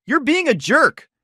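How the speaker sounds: high, loud, slow indignant cry; shrill angry retort. Acted. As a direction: spit it out hurt and furious. Nicole's angry lisped retort.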